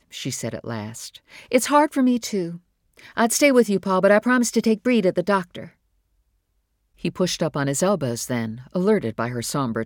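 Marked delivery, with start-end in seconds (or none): 1.5-5.7 a hint of a worn-out mother, higher and louder; weary mother. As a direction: explain gently but tiredly. Mother softening.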